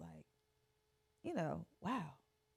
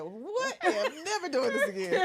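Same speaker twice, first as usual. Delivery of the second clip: high, laughing squeal of regret; laughing mock disbelief; 11 semitones higher, 7 dB louder than usual. To friends about her own surgery, laughing high and loud. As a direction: laugh it out, swearing off ever again. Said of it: "First time I was like".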